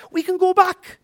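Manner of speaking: high, loud ringing declaration; preaching emphasis. Acted. Preacher urging the church, high loud proclamation. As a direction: proclaim it to the congregation.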